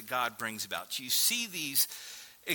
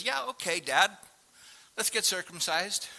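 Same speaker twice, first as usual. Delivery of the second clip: voicing a teenage son; 8 semitones higher than usual. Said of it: "his thirteen-year-old son says".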